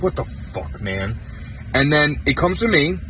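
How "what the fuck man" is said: low, breathy muttered swearing under the breath; muttered curse. Retold or imitated. Poker player muttering at the table in disbelief, muttered. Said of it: "I mutter something to myself like".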